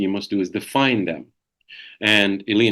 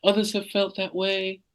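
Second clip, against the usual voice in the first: higher, soft reassurance; smooth it over sweetly; mild sweet calming voice; calm understanding; 10 semitones higher, 3 dB quieter than usual. Shown as a demonstration. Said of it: "But you just say".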